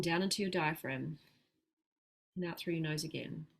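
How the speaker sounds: soft, unhurried breathing instructions; guided breathing. Shown as a demonstration. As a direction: talk them calmly through each breath. Guides a slow breathing exercise.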